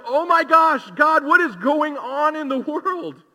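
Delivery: high, loud cry of alarm, slowing on the question; exasperated outcry. Retold or imitated. Preacher imitating fretful believers, alarmed high loud cry. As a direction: throw up your hands, mock-panicked.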